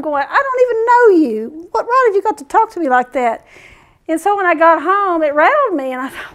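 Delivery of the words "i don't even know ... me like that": high-pitched affronted protest; retold indignation. Shopper protesting the bread guy's tone, mock indignant. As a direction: replay your outrage at a stranger, voice raised in pitch. Acting out indignant thoughts at stranger.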